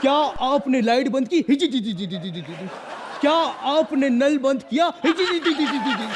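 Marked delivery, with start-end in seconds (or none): 1.5-2.7 sheepish giggle. Acted. Answering the superhero sheepishly. 5.1-6.1 sheepish giggle. Acted. Answering the superhero sheepishly.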